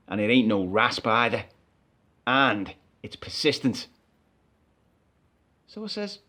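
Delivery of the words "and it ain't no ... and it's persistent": low, slow driver's voice; gruff bus driver. Retold or imitated. Passenger retelling the driver refusing him, low and slow. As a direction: drop low and drag it out like a stern driver.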